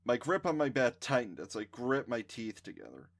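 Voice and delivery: ordinary delivery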